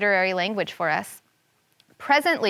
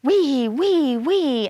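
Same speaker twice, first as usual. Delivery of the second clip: a touch higher "wee, wee, wee" zaps; lightning sound; 4 semitones higher, much slower than usual. Acted. Sky god flashing lightning, light zapping sound. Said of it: "he flashed his lightning".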